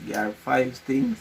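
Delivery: normal talking voice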